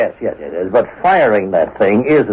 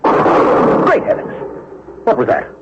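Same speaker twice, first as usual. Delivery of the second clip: startled; 13 semitones higher than usual. Acted. Gunshot heard upstairs.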